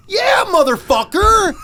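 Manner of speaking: slow, high, loud Yeah motherfucker; angry shout. Real, not acted. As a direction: yell it with real outrage. Incensed at the cop.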